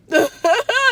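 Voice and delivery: loud, high short laugh; real laugh at the fake-card offer. Real, not acted. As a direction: let out a quick laugh.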